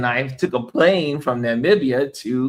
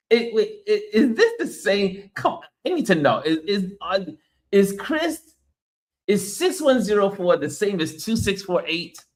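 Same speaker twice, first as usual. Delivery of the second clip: mock suspicious; 8 semitones higher than usual. Real, not acted. Jokingly questioning a viewer's identity.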